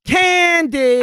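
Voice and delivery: high shouted announcement of candy; big high cry of candy; excited shout. Acted. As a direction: sell the word like a game show reveal. Answers her own big question.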